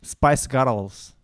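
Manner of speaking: put-on loud Scottish accent, rolled R in Girls. Shown as a demonstration. Showing a friend how Scots say it, Scottish accent. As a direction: belt out Girls as a Scottish "gur-ruls". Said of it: "in a Scottish accent?".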